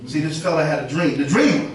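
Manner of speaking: ordinary talk, nothing marked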